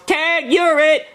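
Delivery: high-pitched mock child shout; piping, playful kid's yell. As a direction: taunt like a kid in a chase. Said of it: "And he yells".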